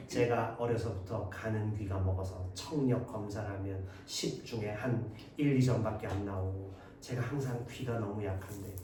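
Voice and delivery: soft, low, self-lowering request; humble plea. Acted. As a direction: beg politely, lowering yourself. Student acts humble plea.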